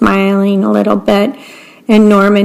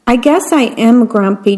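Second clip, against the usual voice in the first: ordinary delivery, nothing marked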